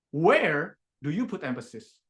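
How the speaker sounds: "Where" stretched and loud, then the rest drops; stress on Where. Shown as a demonstration. Coach shows Tasha where to put emphasis, stressing "Where". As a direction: punch and hold the word "Where". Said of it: "where do you put your emphasis".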